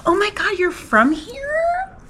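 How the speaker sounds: high, drawn-out shocked squeal; mock amazed. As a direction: gush in disbelief like a tourist. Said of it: "Everybody's like".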